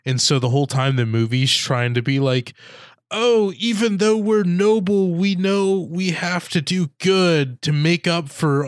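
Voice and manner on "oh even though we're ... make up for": high, put-on earnest plea; mock a noble virtuously excusing themselves. Host parodying nobles with a bloody history, mock earnest.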